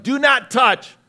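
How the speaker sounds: Adam voiced, sharp warning; stern command. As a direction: lay down the rule like Adam. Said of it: "he knew it was like".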